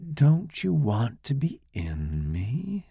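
slow seductive coo. Acted. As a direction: tempt him slowly. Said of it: "in the same voice".

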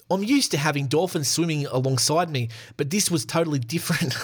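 a weak attempt at a Kiwi accent; a clumsy mock New Zealand accent. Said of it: "let's do a Kiwi accent".

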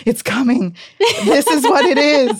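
high, loud thrilled realisation; rising excited whisper-turned-cry; excited inner voice. Retold or imitated.

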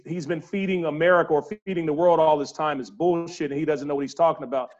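usual speaking voice

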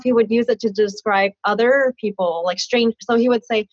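ordinary talk

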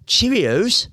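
playful slip into an English accent. Real, not acted. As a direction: say it slowly with a British lilt. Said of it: "I don't know why I went English like that".

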